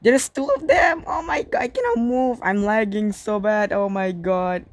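very high panicked shrieking. Real, not acted. Lagging while sharks attack.

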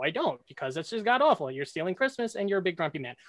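ordinary delivery

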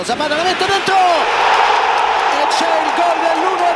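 loud, high, drawn-out goal call. Real, not acted.

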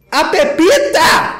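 loud high shout of her name; delighted yell; excited shout. Real, not acted. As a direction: cheer her on as she appears. Excited reaction to model appearing.

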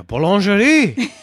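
fake French accent. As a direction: stretch it out like a mock Frenchman. Mock French word game.

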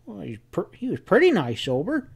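halting start, then high and hedging; cautious. A narrator's reading. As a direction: hedge carefully, stumbling into it. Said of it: "Sam said cautiously".

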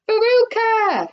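high, drawn-out call; shouting. A narrator's reading. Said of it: "shouted Missus Salt".

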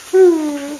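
high falling hum; mock puppy whimper. Owner showing the dog a sad puppy, mock whimper. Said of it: "Let me hear sad puppy".

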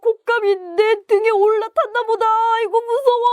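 high wailing comic panic; panicked tiger. Acted. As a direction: cry out in terror, ending in a long howl. Tiger panics, something on its back. (겁에 질려 울부짖는 호랑이)

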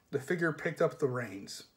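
ordinary delivery, nothing marked

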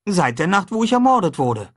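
put-on high, lively boyish voice; a cheerful boy proposing breakfast; boy talking brightly to his friend; young boy Huck. A narrator's reading. Huck's voice.

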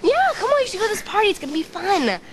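high, lively urging; eager coaxing. Acted. Urging a reluctant friend to a party, eager coaxing.

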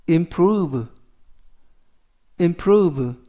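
improve said slowly, clearly, with falling end; model pronunciation. Shown as a demonstration. Teacher modelling improve for students to repeat. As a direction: enunciate improve for learners. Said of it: "improve라고 발음하시면 되겠고요".

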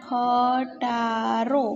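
ખટારો stretched out syllable by syllable; drawn-out ખ, ટા, રો with small gaps; slow dictation. Shown as a demonstration. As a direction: say ખટારો slowly for writing down. Dictates the word to write.

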